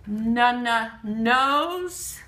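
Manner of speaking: "Nana nose" very slowly, n sound twice before the word; first sound repeated. Shown as a demonstration. Teacher stressing the starting n, "Nana nose" slowly. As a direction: tap out "Nana", then a long "nose". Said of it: "The na is at the beginning".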